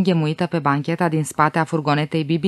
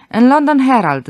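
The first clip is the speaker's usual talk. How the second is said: smiling, pleased; 6 semitones higher, 5 dB louder than usual. A narrator's reading. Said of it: "zâmbi Glick".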